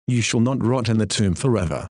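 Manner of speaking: ordinary talk, nothing marked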